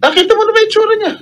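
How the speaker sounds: high, fast indignant outburst; angry confrontation. Real, not acted. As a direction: fire it at the witness in disbelief. Senator angrily confronting the witness. (galit at mataas na boses)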